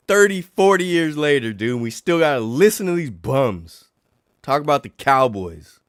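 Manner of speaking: high, loud venting; exasperated rant. Real, not acted. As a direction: vent years of frustration. Venting about Cowboys fans.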